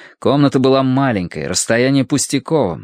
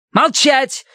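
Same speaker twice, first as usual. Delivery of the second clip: sharp high barked order; barked command. A narrator's reading. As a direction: snap it out as a command. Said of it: "рявкнула женщина".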